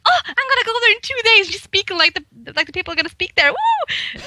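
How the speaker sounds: high, bubbly, gleeful run ending in a whoop; excited retold thrill. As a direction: gush with giddy joy about the trip, then whoop. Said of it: "I was like".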